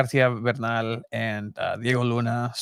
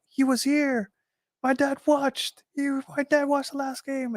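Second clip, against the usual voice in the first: lightly voiced young son, much higher; mock-tearful boy recalling his dad, pitched way up; imitating the boy in the film; 13 semitones higher than usual. Retelling the Goal scene, a high mock boy's voice.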